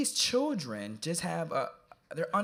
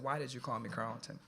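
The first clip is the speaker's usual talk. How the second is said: much softer, steady, serious; intense hushed question; 3 semitones lower, 10 dB quieter than usual. Retold or imitated. Speaker facing the boy in his row, asking much softer. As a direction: ask it eye to eye, holding him there.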